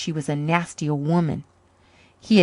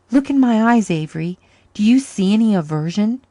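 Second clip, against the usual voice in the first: earnest plea; 5 semitones higher, 8 dB louder than usual. Acted. Man pleading with scarred woman.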